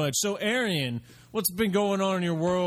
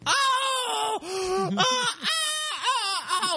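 much higher, frantic startled oh-cries; panicked yelps; much slower than usual. Acted. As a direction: yelp in mock panic like a cornered man. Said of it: "here's my impression".

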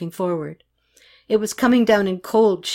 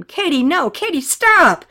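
high shout building louder; acted alarmed yell, peaking at the end; desperate shout; 9 semitones higher, 6 dB louder, slower than usual. A narrator's reading. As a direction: stop her running into danger. Renee shouts to stop Katy.

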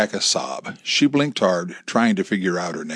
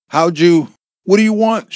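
high, loud startled question; rattled, pitched up and urgent; alarmed demand; 9 semitones higher, 9 dB louder than usual. A narrator's reading. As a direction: blurt it out in alarm. Alarmed wife answers threatening caller.

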